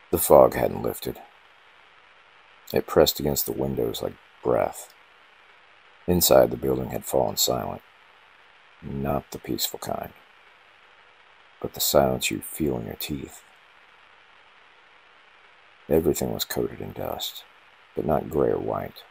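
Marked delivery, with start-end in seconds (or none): none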